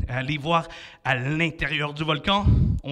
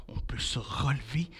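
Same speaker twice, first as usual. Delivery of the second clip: whisper; 4 semitones lower, 9 dB quieter than usual. Shown as a demonstration. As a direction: hush so the dragon can't hear. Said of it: "sans faire de bruit".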